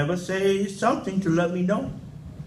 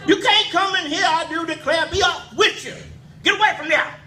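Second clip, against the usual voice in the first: granny shooing devil; 12 semitones higher, faster than usual. Retold or imitated. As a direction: shoo him off like a feisty old granny.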